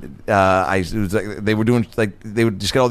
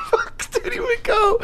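ordinary delivery

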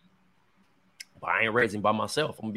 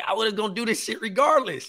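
raised, insistent retort; emphatic reply; 9 semitones higher than usual. Retold or imitated. Son telling sick mom he'd help regardless, raised. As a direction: push back hard and high. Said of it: "And I'm like".

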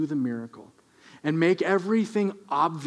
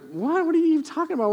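much higher, incredulous what; baffled disbelief; 11 semitones higher, much faster than usual. Acted. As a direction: recoil in confused shock. Homeowner baffled by request.

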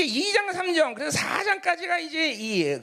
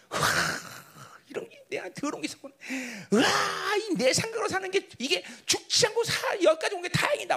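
acted awestruck realization; 7 semitones higher than usual. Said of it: "막 이러면서".

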